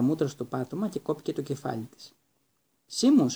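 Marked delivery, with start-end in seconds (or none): 2.9-3.4 high, loud call of the name. A narrator's reading. Teacher calling the next pupil forward, loud call. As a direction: summon the next pupil loudly. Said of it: "φώναξε ο καθηγητής Λούπιν".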